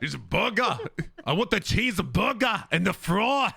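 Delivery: put-on botched accent drifting into German; German-sounding accent. Trying an American accent on a burger order, sounds accidentally German. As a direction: attempt an accent that slips into German. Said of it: "It almost sounded like German".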